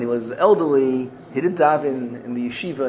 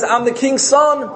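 high, slow, emphatic claim; insistent protest; 11 semitones higher, 4 dB louder than usual. Acted. Son answers the king's officers, high insistent protest. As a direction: insist on who you are, pitched up and drawn out.

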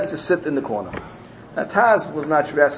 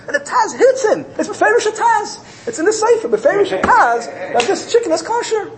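high, excited exclamation; sudden realization; 4 dB louder than usual. Retold or imitated. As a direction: burst out with the discovery, pitch soaring. Sudden realization at story's climax.

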